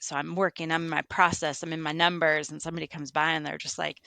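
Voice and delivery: ordinary talk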